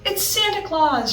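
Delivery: high joyful squeal; little girl squealing with joy. A narrator's reading. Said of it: "Isabel squealed with joy".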